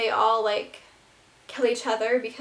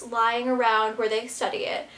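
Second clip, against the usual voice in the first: usual speaking voice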